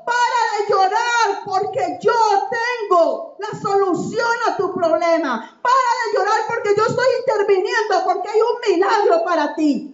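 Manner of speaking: impassioned Jesus reassuring the mother; Jesus's reassuring voice. Acted. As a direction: comfort her with urgency. Voicing Jesus, preaching.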